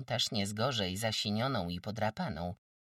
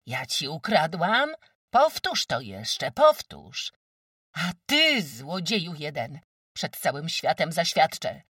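outraged shout; 9 semitones higher, 9 dB louder than usual. Acted. Accused of theft, shouting back.

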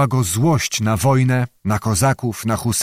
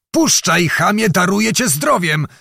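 higher, urgent demand; repeated captive's cry; 6 semitones higher than usual. A narrator's reading. Blinded captive bargaining with supposed captor, higher urgent cry. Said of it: "krzyknął wreszcie przyduszonym głosem".

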